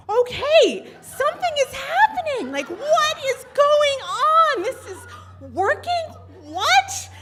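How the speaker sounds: high, loud squeal of amazed panic; excited disbelief. Retold or imitated. Storyteller reliving the webcam stranger reacting, high excited squeal. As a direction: shriek it like you cannot believe it works.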